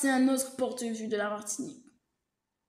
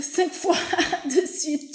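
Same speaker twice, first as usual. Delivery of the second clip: laughing; 5 semitones higher, 6 dB louder, slower than usual. Real, not acted. Laughing at the shirt idea.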